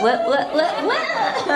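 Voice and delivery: rising squeaky what; hammed-up innocent flashing bit; mock flustered. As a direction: play it dumb and coy. Mimicking awkward bra flash.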